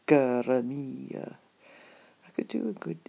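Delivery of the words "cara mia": "Cara mia" stretched slowly, loud and passionate; Addams Family romantic. Retold or imitated. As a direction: drawl "Cara mia" like a smitten gentleman. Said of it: "what was it the Addams Family chap did".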